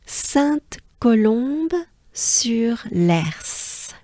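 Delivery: slow, careful syllable by syllable; drawn-out demo pronunciation; slow full name. Shown as a demonstration. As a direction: say Sainte-Colombe-sur-Lers slowly and clearly. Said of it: "Take a good breath".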